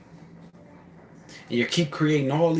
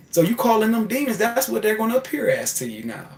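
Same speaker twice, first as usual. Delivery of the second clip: high, ominous chaos voice; 10 semitones higher, 4 dB louder than usual. Pretend. Chaos telling the fearful driver demons will appear, high and mocking. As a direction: warn them, pitched up, like a mocking cosmic force. Chaotic mind warns of demons.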